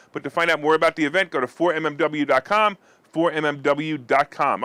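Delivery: usual speaking voice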